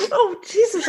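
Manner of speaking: gasping laugh with a snort. Real, not acted. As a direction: laugh so hard you snort. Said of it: "I just snorted".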